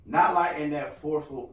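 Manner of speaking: usual speaking voice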